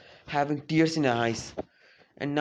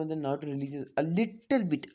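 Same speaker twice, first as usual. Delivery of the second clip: ordinary talk, nothing marked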